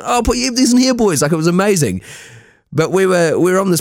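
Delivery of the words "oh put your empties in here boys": imitating cheerful cops. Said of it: "walking around with bins going".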